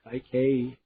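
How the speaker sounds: plain reading voice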